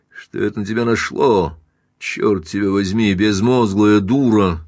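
plain reading voice

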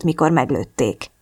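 plain reading voice

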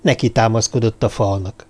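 plain reading voice

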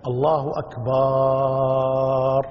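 الله أكبر very slowly with أكبر drawn into أكبار; wrong stretched ba. Shown as a demonstration. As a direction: draw out أكبر slowly like a chant. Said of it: "يجعل بعدها ألفا". (مد الباء حتى تصير أكبار)

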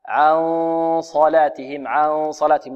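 "عن صلاتهم" with the ن hummed, coloured by the heavy ص; nasal hiding before ص. Shown as a demonstration. Teacher shows the hidden noon, heavy hummed ن in "عن صلاتهم". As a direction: hold a dark ghunnah into "صلاتهم". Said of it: "هكذا".

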